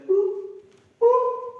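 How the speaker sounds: very high, loud straining boops; cartoon boop. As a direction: squeak it like a monkey tugging its stuck hand. Monkey stuck pulling hand.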